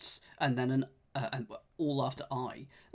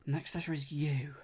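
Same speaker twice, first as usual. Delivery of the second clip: ordinary talk, nothing marked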